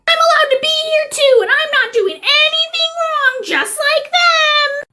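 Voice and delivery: very high, whining squeal; shrieking protest. Acted. Woman protesting her rights to police, shrieking. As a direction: screech it, dragging out the last words.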